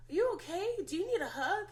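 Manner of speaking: put-on high, lilting check-in with a child; coaxing concern. Adult checking on a frustrated little boy, coaxing concern. Offering upset child a hug.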